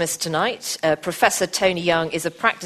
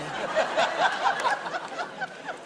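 soft high laugh; 5 dB quieter than usual. Real, not acted. Laughs at the mix-up.

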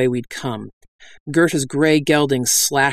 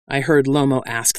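ordinary delivery

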